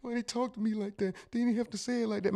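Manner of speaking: hurt worker whining about his boss, mock teary; mock hurt whine. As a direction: imitate someone sulking in the car. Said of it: "shedding tears".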